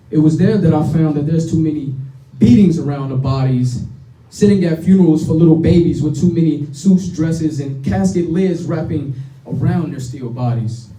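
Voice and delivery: rhythmic spoken word delivery; spoken word poetry. Acted. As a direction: perform it like a slam poem. Spoken word poem performance.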